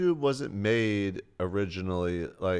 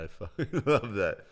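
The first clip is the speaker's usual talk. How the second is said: laughing; 3 semitones higher than usual. Real, not acted. Laughing at prank memory.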